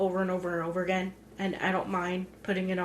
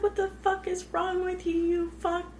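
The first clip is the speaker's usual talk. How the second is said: imitating her outrage; 11 semitones higher than usual. Imitating heroine cursing passing drivers, high-pitched mock outrage. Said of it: "but the way she does it".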